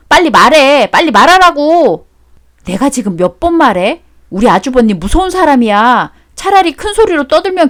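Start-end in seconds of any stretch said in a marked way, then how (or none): 0.1-2.1 loud, rising angry pressing; louder insistent command; impatient demand. Acted. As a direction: cut him off and demand answers. Demanding confession.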